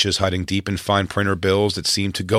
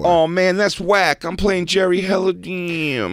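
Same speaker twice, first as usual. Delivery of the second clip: whiny high voice; 11 semitones higher, slower than usual. Retold or imitated. Voicing Jamie Kennedy.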